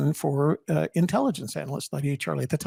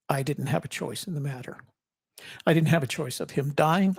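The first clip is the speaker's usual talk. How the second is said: usual speaking voice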